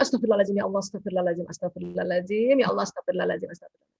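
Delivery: low, soft murmured prayer fading away; quiet istighfar. Shown as a demonstration. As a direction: murmur it softly to calm yourself. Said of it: "istighfar aja". (istighfar lirih dan rendah sampai hilang)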